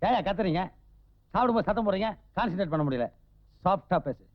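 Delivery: softly chiding the noisy squabblers; soft complaint. Acted. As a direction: complain quietly, asking for hush. Calm hostage chides squabbling captors while eating.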